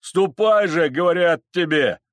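high, loud command; forceful, exasperated crowd shout; impatient shout. Acted. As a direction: yell at him to get moving. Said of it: "кричали запорожцы".

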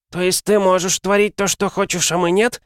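put-on high, insolent young lad; mock the boss like a brat; cheeky boy voice. Cheeky redhead boy challenges hero.

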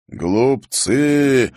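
echoing ethereal energy being; booming roar. A narrator's reading. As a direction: roar it from inside the mirror. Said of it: "взревел гулкий голос". (гулкий рёв)

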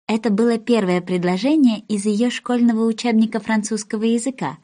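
ordinary delivery

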